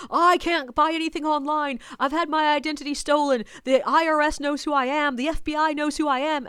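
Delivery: high, insistent ramble; interrupting stranger. Retold or imitated. Stranger going on and on to the guide, insistent. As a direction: imitate a man cutting in and rattling on. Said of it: "he interrupts me to be like".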